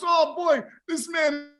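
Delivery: high-pitched Jamaican comic impression; mock Jamaican accent. Host retelling a plane sketch, high mock Jamaican accent. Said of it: "he goes".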